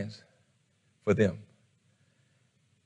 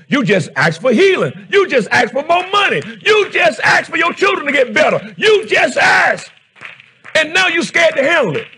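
high, loud, hammering preaching; shouted preaching; 14 semitones higher, 5 dB louder than usual. Acted. Pastor shouting the congregation toward prosperity, fervent preaching. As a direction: build and shout each line at the church.

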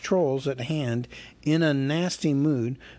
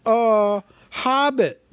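frightened hobbit; 9 semitones higher, 5 dB louder, much slower than usual. A narrator's reading. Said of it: "shaking all over".

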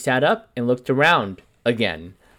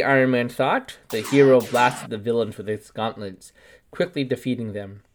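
plain reading voice

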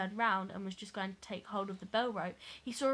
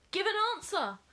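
raised, high-pitched demand; crying out; 8 semitones higher, 3 dB louder than usual. A narrator's reading. Reader voicing a boy challenging a ghostly figure, crying out. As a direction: call it out like the boy in the tale. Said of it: "cried the boy".

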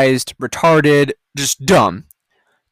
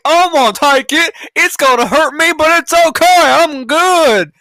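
high, breezy mock confidence; mock naive voice; 9 semitones higher, 3 dB louder than usual. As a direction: play a carefree fool shrugging it off. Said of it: "people that are like".

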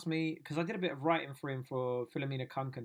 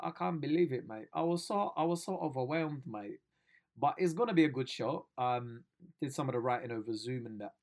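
usual speaking voice

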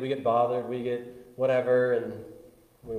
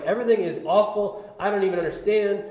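mock complaining; 8 semitones higher, 8 dB louder than usual. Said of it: "you're just like".

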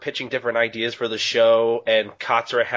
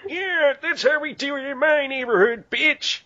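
high squeaky tough-guy act; mock gangster voice. Mock gangster bravado about Hata.

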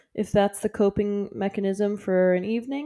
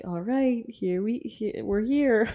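tired voice; 3 semitones higher, slower than usual. Retold or imitated. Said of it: "you can just hear that we're both like".

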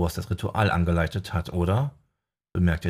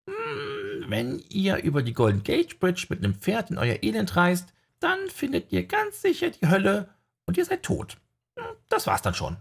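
very high squeaky sidekick voice; high comic voice; 12 semitones higher than usual. Acted. Sidekick Archie retelling prophecy, high squeaky voice.

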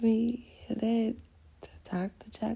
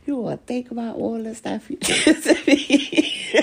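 louder, breaking into laughter mid-line; a jilted woman laughing at her own threat; laughing through it; 3 semitones higher, 7 dB louder than usual. Retold or imitated. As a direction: warn him but crack up laughing.

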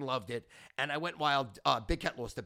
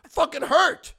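much higher and louder, indignant outcry; loud complaint; 11 semitones higher, 13 dB louder than usual. Real, not acted. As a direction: yell how much it hurt. Complaining about glass skillet hit.

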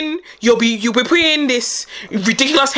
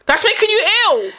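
higher, hammed-up scolding mum; mock scolding mum; 8 semitones higher than usual. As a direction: stress on "making", voiced higher as a scolding mum. Repeating the mum's scolding.

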